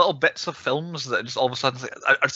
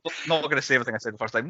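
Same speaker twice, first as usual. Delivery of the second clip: ordinary talk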